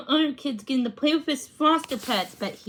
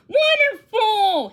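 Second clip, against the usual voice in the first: much higher, louder, slow crow of delight; 9 semitones higher, 7 dB louder, slower than usual. A narrator's reading. Mister Baker delighted at the animals, crowing loudly. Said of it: "he crowed".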